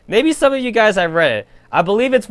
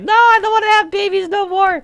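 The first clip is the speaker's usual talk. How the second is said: very high creature voice, refusing; much higher imitated Yukuri saying no. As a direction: pipe up like a stubborn little blob. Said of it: "the Yukuri is like".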